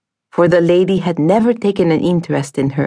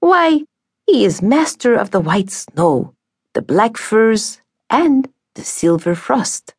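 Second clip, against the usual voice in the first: mock-admiring stepmother; 6 semitones higher than usual. Stepmother praises the bridegroom.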